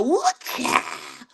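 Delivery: high, put-on sing-song catchphrase; mock his flamboyant exclamation up high; hammed-up campy holler; imitating Sir King. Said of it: "he over there".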